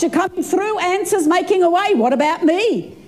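normal talking voice

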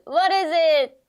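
loud, slow build to a high squeal; host a kids' quiz reveal, ending in a whoop; excited cheer. Pretend. Teacher revealing the frog answer, excited cheer.

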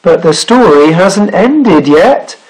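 slow, lilting delivery; teasing sailor. A narrator's reading. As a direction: keep the listener hanging. Sailor teases with unfinished story.

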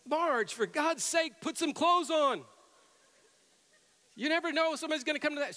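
high exasperated scolding, imitating dad; mock a fed-up husband pleading with his wife; infuriated father. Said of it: "just infuriated my father".

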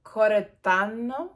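a slow, hammed-up Italian accent on Coretano, rising at the end; a failed attempt at an Italian accent. Shown as a demonstration. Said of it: "I tried to do it with an Italian accent".

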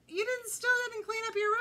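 high, loud scolding mother; mother again scolding about the room; nagging mother. Acted. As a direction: scold him like an exasperated mom. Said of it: "she said".

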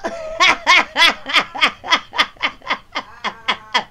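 high, loud cackling laugh; cackling at her own comeback; loud cackle. Real, not acted.

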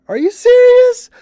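loud, very high, stretched-out incredulous cry; mock disbelief. Hero seeing aliens everywhere, hammed-up incredulous yell. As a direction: squeal it slowly in exaggerated disbelief.

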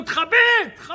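loud high shout from upstairs; furious landlord shouting down; landlord yelling. Retold or imitated. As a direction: yell at him to put it out. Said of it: "בעל הבית מלמעלה צועק לו".

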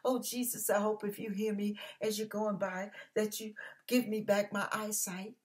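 soft, timid mock prayer; hushed, even and unassuming plea; quiet prayer. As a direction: pray meekly under your breath. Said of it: "said a little quiet prayer".

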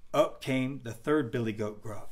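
usual speaking voice

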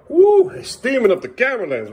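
loud, high excited woo; dad whooping over steaming pancakes. Real, not acted. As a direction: whoop at the steam fogging the lens.

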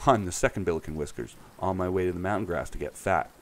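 ordinary delivery

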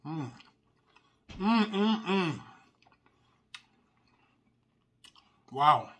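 chewing while humming; savoring a bite; mouth full. Real, not acted. Seen 0: Mouth closed, jaw working after forkful at -4; food unclear.

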